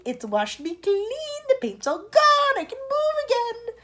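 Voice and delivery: very high, loud excited shout. Acted. Centipede shouting with joy at being free, high and loud. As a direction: shout with glee. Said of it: "shouted the centipede excitedly".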